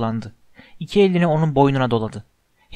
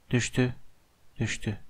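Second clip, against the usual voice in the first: narration dropping softer and lower; fading away; 3 semitones lower, 9 dB quieter than usual. A narrator's reading. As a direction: let each word sink further into quiet. Narrator fades as hero falls. (giderek alçalıp sönen ses)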